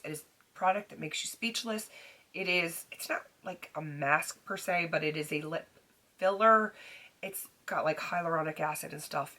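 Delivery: normal talking voice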